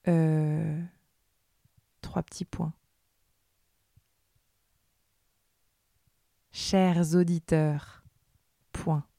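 dictating. Acted. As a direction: read it out like a school dictée, word by word. Dictée read aloud with punctuation.

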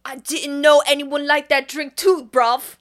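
loud, hammed-up Cockney; Cockney accent. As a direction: put on a brash London geezer accent. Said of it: "Does he really have this Cockney accent".

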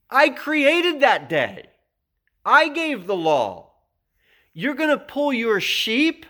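high, loud, incredulous challenge in Jesus's voice; indignant Jesus. Retold or imitated. Preacher voices Jesus confronting fellas over the Sabbath, indignant. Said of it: "this is Jesus speaking".

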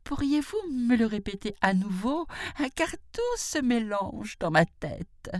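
high-pitched, bewildered jackal voice; muddled jackal. A narrator's reading. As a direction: plead in a high voice, all confused. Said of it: "dit le chacal". (chacal embrouillé, voix haut perchée)

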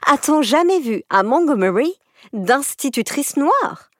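put-on high, scornful spiteful girl; insolent scorn. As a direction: sneer at the idea. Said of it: "l'insolente lui coupa la parole".

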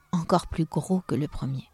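plain reading voice

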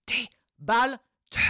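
sudden loud, high projected words; voice bursts out. Shown as a demonstration. Coach shows voice breaking out of a whisper, loud. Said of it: "Je vous reprends exactement la même phrase".

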